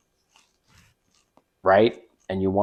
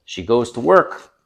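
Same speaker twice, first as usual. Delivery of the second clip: ordinary delivery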